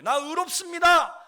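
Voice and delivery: high, loud proclamation of faith; bold declaration. Shown as a demonstration. Preacher showing believers how to confess righteousness, bold declaration. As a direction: declare it out loud for all to hear.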